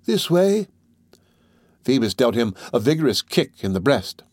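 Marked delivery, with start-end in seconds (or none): 0.0-0.7 higher urgent call; higher, urgent call to follow. Acted. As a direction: drag him along, call him on. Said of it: "said the deaf man".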